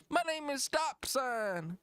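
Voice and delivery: high, tired, muddled rapper parody. Acted. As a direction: stumble through your own stage name, worn out. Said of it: "that's a fucking [name] performance".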